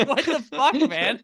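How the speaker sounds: high, loud, laughing disbelief; incredulous protest. Retold or imitated. Reacting to the heckler's remark, high loud incredulity. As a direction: protest the heckle in amused outrage.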